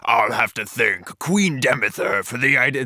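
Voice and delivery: sinister villain voice. Acted. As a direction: play it as a scheming villain. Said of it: "It's just my villain voice".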